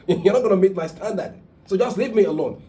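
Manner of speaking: high, loud retort of a fed-up wife; frustrated protest. Retold or imitated. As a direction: imitate a wife pushing her husband away. Voicing a frustrated wife's protest.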